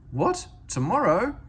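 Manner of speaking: high, loud startled question; sudden surprise. A narrator's reading. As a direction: jolt awake at the news. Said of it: "Skarloey woke suddenly".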